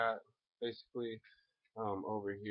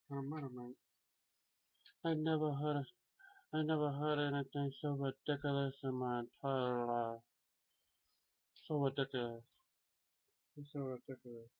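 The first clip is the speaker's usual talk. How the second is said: higher, put-on cartoon voice; cartoon mashup voice; 5 semitones higher than usual. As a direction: keep the goofy three-in-one voice. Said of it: "Daffy Duck, Butthead, and Donald Trump in one".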